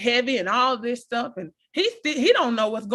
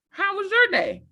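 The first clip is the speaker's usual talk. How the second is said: higher, bright eager asking of how was your day; higher eager question; 9 semitones higher than usual. Retold or imitated. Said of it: "I experienced that".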